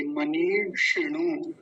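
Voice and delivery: मनीषिणु slow, long नी and quick षि; long then short vowel. Shown as a demonstration. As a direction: draw out नी in मनीषिणु, keep षि brief. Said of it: "पहली मात्रा लंबी खींची जाएगी".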